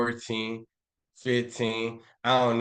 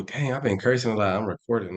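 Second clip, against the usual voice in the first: usual speaking voice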